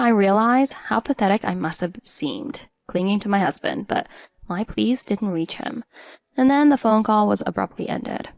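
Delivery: plain reading voice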